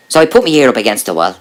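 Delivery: ordinary delivery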